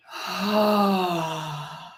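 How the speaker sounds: long slow breath out through the mouth; deep audible exhale. Shown as a demonstration. Coach showing the breath for the group, a deep sigh. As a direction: demonstrate a releasing sigh. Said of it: "take a deep breath in".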